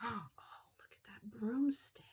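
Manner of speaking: hushed amazed whisper. Real, not acted. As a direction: breathe it in wonder.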